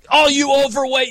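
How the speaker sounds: high, mocking heel jab at the crowd; wrestling heel mocking the crowd, hammed-up; mock wrestling heel taunt. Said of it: "the guy comes out and he's like".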